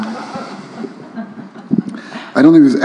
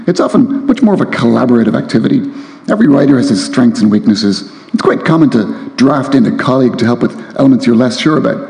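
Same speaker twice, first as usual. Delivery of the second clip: lively reading voice, a little higher; reading aloud; 3 semitones higher than usual. A narrator's reading. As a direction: read the novel with relish to the crowd. Author reads novel excerpt aloud.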